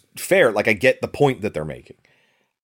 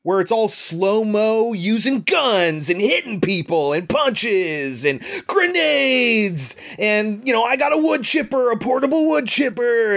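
hammed-up action-movie excitement, pitched way up; mock action hype; 9 semitones higher than usual. Mocking the film's action scene.

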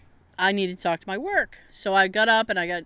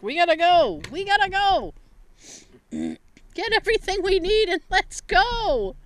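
high-pitched mock panic to leave; retold urgency; 9 semitones higher than usual. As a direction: urge them out in a raised pitch. Retells wanting out of store.

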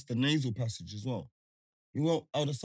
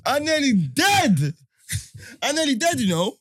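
high and loud, laughing through it; half-laughing outcry, much higher; excited exclaiming; 11 semitones higher, 8 dB louder, slower than usual. Retold or imitated. Retelling his reaction to friend, high, loud and excited. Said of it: "I'm saying".

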